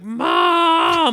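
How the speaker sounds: high, loud cartoonish wail; drawn-out mock-panicked "Mom!"; alarmed cry for Mom. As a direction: holler for your mother in a panic. Kid wakes alarmed, calls mother.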